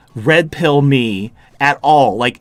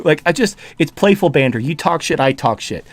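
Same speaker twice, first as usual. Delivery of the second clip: ordinary talk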